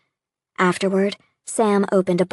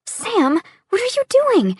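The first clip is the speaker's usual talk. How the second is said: breathless high astonishment; stunned disbelief; 4 dB louder than usual. Acted. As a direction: voice leaps way up in shock. She sees him kneel to propose.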